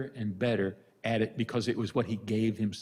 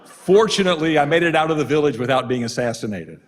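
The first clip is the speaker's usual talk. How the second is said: 1 laughing punchline; 4 semitones higher, 10 dB louder, faster than usual. Real, not acted.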